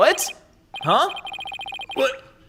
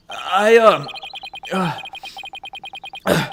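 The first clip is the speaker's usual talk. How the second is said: usual speaking voice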